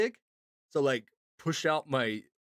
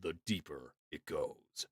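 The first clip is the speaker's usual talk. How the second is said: much lower, softer and slow; deep voice demo; 11 semitones lower, 12 dB quieter, slower than usual. Shown as a demonstration. Voice acting tips for chat, dropping into a deep slow voice. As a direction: sink the voice right down with air. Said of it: "the more air you have".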